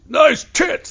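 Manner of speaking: very high, loud catcall across the water; yelled catcall. Retold or imitated. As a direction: holler it boat to boat. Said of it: "they yelled out at Stacey".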